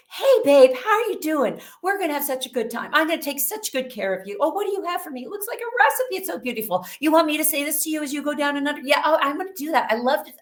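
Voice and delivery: high-pitched, fast gushing chatter; chatty Cathy. Retold or imitated. Anesthesiologist fussing over her patient, chatty and gushing. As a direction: imitate a suddenly bubbly, overfriendly caregiver. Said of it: "she went from frozen to chatty".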